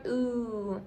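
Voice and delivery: soft, drawn-out "Ooh", the vowel in suit; U sounded as ooh. Shown as a demonstration. Teacher pointing out the U in suit, soft long "Ooh". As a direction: hold "Ooh" softly as one long vowel. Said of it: "You hear that U".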